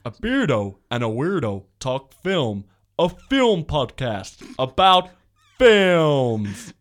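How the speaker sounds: slow, loud trailer boom; drawn-out announcer read; movie trailer voice. Pretend. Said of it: "should I do my movie voice?".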